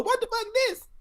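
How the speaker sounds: squeaky high outraged mock yelp; high mock Chris Tucker squeal. Friend imitating Chris Tucker's shocked stage reaction, high squeal.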